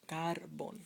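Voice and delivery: "carbón" said CAR-bon, drawn out; wrong first-syllable stress. Shown as a demonstration. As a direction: slowly lean on the CAR of "carbón". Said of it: "not here".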